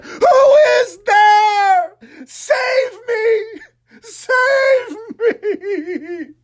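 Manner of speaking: high, slow sobbing scream; man in the dark begging for rescue, sobbing; crying out in terror. Acted. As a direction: scream for help through tears. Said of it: "with tears streaming down his face, cried".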